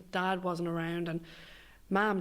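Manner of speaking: normal talking voice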